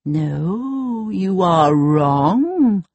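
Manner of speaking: slow, solemn magic mirror; lightly voiced mirror; shimmering echoing mirror voice. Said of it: "the mirror said".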